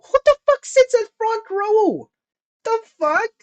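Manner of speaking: high, incredulous rant; high-pitched outrage at the front row; outraged disbelief. Real, not acted. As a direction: squeal it in disbelief.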